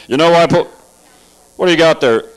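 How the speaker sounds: a hint of gruff cop; brisk suspicious officer. Cop spotting something in the car, suspicious gruff voice.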